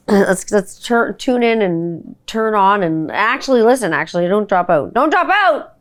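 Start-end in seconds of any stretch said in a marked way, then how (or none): 4.9-5.7 high exclaimed warning; emphatic call. Pretend. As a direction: call it out, pitched up. Repeats the warning louder.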